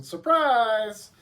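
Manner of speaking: loud, high, drawn-out surprise; mock surprise. Preacher springs the familiar Gospel on friends, mock surprise. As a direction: playfully belt out a long surprise.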